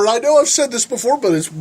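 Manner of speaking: normal talking voice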